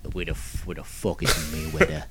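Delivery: a hushed, mock Irish Conor line; quiet mock Irish. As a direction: mutter the Conor line low under the laughs. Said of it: "tiny little Conor McGregor".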